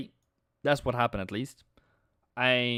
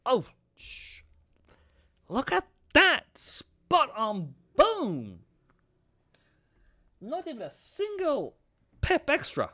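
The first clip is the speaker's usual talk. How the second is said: thrilled; 11 semitones higher than usual. Real, not acted. Trader seeing the exact target hit, high and thrilled. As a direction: shout it up high, astonished.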